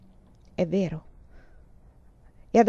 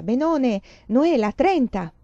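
higher, louder, slowed, triumphant; excited news; 5 semitones higher, 5 dB louder, slower than usual. Acted. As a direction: announce the top grade proudly. Andrea announcing his exam grade.

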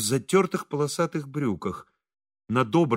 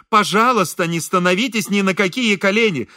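higher, louder stern woman; 5 semitones higher, 4 dB louder than usual. A narrator's reading. Wife forbidding the visitor to kneel, stern. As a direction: forbid it firmly and loudly. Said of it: "сказала она грозно".

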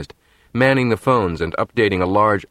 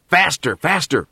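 urging; 7 semitones higher, slower than usual. Acted. Urging driver in jeep.

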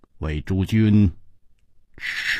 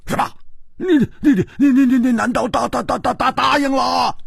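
much higher, louder and fast, stuttering 你 and 答; loud stammering; 12 semitones higher, faster than usual. Acted. Hu Tiehua seizing Chu Liuxiang, loud panicked stammer. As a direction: grab him and sputter out loud. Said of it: "大声地说".

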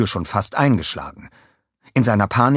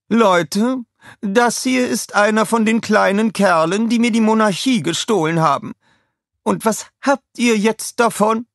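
high, loud mocking queen voice; old queen; 14 semitones higher, 5 dB louder than usual. A narrator's reading. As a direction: show him off to the crowd, scornful. Queen mocks the minion to patrons. (hohe, spöttische Königinnenstimme)

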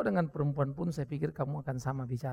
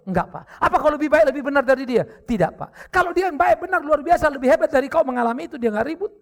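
loud, higher, driving rhetorical questions; forceful preaching; 7 semitones higher, 9 dB louder than usual. Acted. Pastor confronting the wife with Job's story, forceful preaching. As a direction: press each question home.

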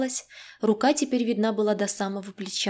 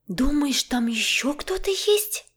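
higher, slow breathless dread; frightened gasp; 5 semitones higher, slower than usual. A narrator's reading. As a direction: gasp the question in alarm. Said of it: "ахнула Женька".

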